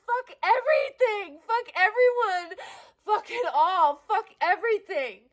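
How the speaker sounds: screaming out in raw despair; a breaking-down shout, crying and raging; real furious shout. Real, not acted.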